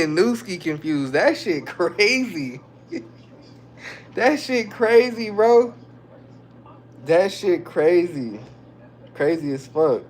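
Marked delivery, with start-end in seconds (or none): 1.1-8.4 laughing. Real, not acted.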